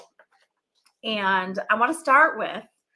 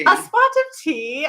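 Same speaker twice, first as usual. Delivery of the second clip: slow, louder mock English lilt; badly faked English accent, higher and drawn out; attempted English accent; 6 semitones higher, 6 dB louder, slower than usual. Host imitating a friend texting about tea, attempted English accent. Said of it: "I can't ever do her beautiful English accent".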